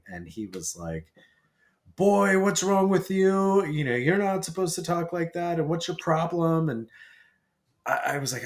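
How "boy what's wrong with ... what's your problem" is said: scolding dad. Retold or imitated. Dad who heard his son cussing, imitated scolding. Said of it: "my dad heard me cussing".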